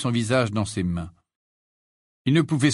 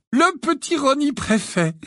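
much higher proud cooing; high gushing mother; 4 dB louder than usual. A narrator's reading. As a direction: beam over little Ron.

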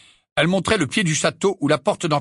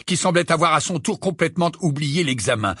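plain reading voice

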